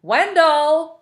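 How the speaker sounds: high, loud call down the hall. A narrator's reading. Missus Griffin calls out to Wendell, loud and high. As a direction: call the boy's name.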